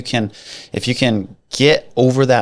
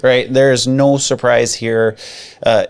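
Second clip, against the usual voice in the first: usual speaking voice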